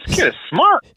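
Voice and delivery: high, admiring exclamation; impressed remark. Retold or imitated. Praising the boy's jury duty explanation, high impressed voice. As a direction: let the voice jump up in admiration. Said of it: "And I was like".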